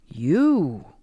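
drawn-out question swooping up and down; incredulous one-word echo; surprised. A narrator's reading. Zachariah learns Charlotte is the accused, astonished. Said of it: "Again he seemed surprised".

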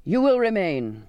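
firm, carrying captain's order; crying out. A narrator's reading. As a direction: command that halts someone leaving. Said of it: "the captain cried out".

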